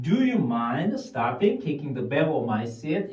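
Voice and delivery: slow, words run together as one; slow linked drill. Shown as a demonstration. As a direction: link the words smoothly, slowly. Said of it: "어떤 사람은". (단어를 이어서 천천히 말하기)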